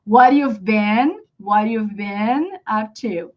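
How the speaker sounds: slow, repeated drill delivery; slow linked practice. Shown as a demonstration. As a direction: slowly blend "you've been" together. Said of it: "you gotta practice".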